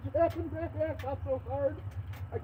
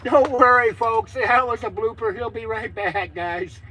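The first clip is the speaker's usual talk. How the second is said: booming mock announcement to viewers; loud host voice; 14 dB louder, slower than usual. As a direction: call it out loudly like a show host. Addresses viewers after the blooper.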